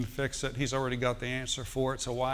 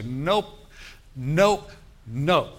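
firm refusal; 5 dB louder, much slower than usual. Acted. Rejecting Satan's thought aloud.

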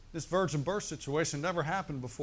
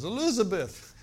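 loud high mock call of Elizabeth; Fred Sanford impression; 5 semitones higher, 5 dB louder than usual. Preacher imitating the sitcom father calling out. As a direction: cry out Elizabeth like the sitcom dad. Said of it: "I always think of Sanford and Son".

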